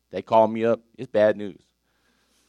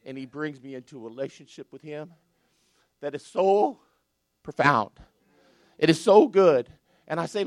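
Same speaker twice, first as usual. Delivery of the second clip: ordinary delivery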